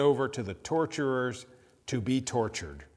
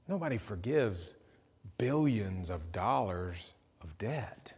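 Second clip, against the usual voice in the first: quiet, wondering disbelief; hushed awe; 4 semitones lower, 7 dB quieter than usual. Retold or imitated. Followers marvel to Jesus at the story, hushed awe. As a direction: breathe it out, amazed.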